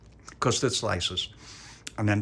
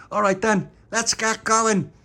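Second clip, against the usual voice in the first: eager call; 13 semitones higher, 8 dB louder, slower than usual. Acted.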